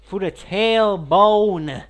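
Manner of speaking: loud slow shout; exaggerated shout. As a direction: blast it out dramatically. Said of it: "exaggerate it by my feelings".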